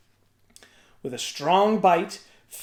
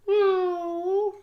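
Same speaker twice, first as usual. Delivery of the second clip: put-on much higher babyish meow; huge baby voice; 13 semitones higher than usual. A narrator's reading. Finn in the cradle mewing at the giant. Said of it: "said the huge baby".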